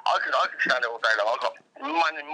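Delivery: ordinary talk, nothing marked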